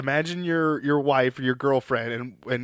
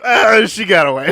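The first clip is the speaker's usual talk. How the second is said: loud, higher-pitched throwaway groan; mock dismissive; 5 semitones higher, 8 dB louder than usual. Co-host mimicking the engineer giving up the chase, mock dismissive. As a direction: play up shrugging off the chase.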